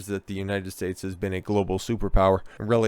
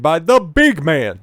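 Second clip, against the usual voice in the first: slow, raised, louder on big man; mock emphasis; 7 semitones higher, 9 dB louder, slower than usual. Real, not acted. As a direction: lean into it with sarcasm. Mocking nickname for Trump.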